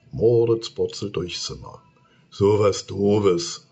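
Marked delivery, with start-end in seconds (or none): 2.3-3.7 slow, louder contemptuous monster; grumpy snap. Acted. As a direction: spit it out scornfully. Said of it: "faucht das Monster nur".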